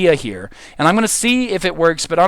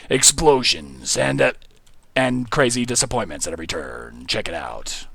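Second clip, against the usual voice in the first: movie trailer voice; 6 semitones lower, 5 dB quieter than usual. Pretend. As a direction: sell it like a dramatic coming-soon ad. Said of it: "I'm really loving the movie theater voice".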